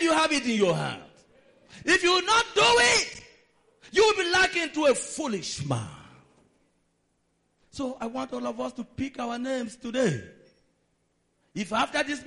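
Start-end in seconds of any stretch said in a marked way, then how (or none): none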